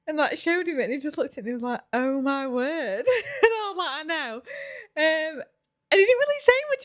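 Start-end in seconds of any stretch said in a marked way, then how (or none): 1.9-3.4 slow, tearful laughing gasp; laughing in shock. Retold or imitated. Boyfriend seeing the pregnancy test, slow laughing gasp. Jake sees the positive test. 3.4-6.8 real tears breaking through, voice pitched up high; crying while laughing. Real, not acted. Telling the camera how he reacted, real happy tears. Retelling the moment he saw it.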